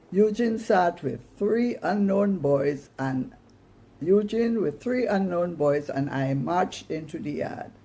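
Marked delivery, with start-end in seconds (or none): none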